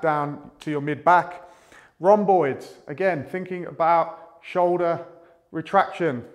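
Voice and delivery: normal talking voice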